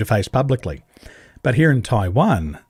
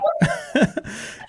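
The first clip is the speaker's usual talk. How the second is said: high breathy laugh; 4 dB quieter than usual. Real, not acted. Laughs after his story.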